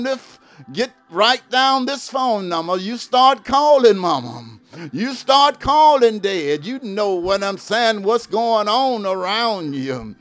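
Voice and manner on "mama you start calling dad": normal talking voice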